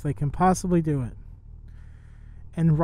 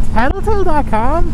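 higher, louder stunned question; incredulous reread; 8 semitones higher, 6 dB louder than usual. Real, not acted. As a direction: say it again as if you can't believe it. Rereading the sign in shock.

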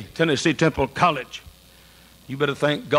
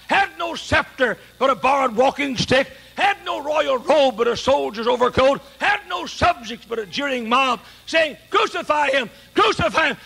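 full-force preaching; 9 semitones higher than usual. Acted. Preacher rebuking the bitter congregation, full-force preaching. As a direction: hammer each "What?" like a challenge. Rhetorical litany against bitterness.

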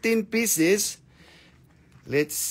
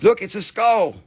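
ordinary talk

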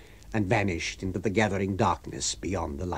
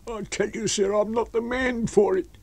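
high-pitched pleading refusal; protesting tramp; 12 semitones higher than usual. A narrator's reading. Tramp telling his master he is unfit, protesting.

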